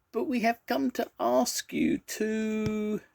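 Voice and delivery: tiny, high, slow and hesitant; very small voice. A narrator's reading. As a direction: inch timidly through the request, very high. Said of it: "in a very small voice".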